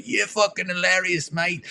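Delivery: high-pitched British accent impression. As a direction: put on a high, excited British rocker. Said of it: "[name] was like".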